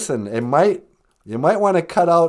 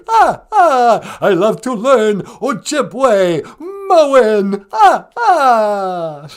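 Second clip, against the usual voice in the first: put-on loud, theatrical vampire count with an accent; Count Von Count; 2 semitones higher, 4 dB louder than usual. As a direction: ham up the counting vampire, rolling "ah, ah". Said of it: "It is I, the Count. Count Von Count".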